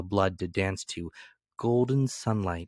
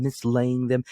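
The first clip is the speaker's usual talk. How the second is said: usual speaking voice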